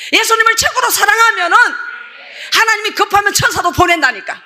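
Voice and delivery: high, fervent revival preaching; full-force preaching. Real, not acted. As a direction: cry out hallelujah, then keep exhorting at high pitch. (높고 힘차게 외치는 부흥회 설교)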